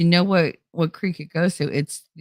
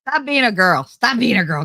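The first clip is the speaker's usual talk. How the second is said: higher imitation of a scolding friend; mock scolding; 4 semitones higher, 4 dB louder than usual. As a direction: imitate him telling you off, pushed a little. Retelling his man-up scolding.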